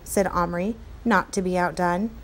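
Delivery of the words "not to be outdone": ordinary delivery, nothing marked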